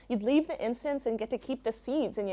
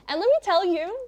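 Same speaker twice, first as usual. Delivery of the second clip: emphatic; 9 semitones higher, 10 dB louder than usual. Real, not acted. Emphatic setup.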